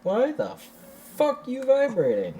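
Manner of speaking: startled. Real, not acted. Controller suddenly vibrates.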